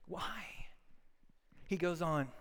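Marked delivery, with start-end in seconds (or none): 0.1-0.7 soft unvoiced whisper. Preacher asking the congregation in a whisper. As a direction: breathe the question.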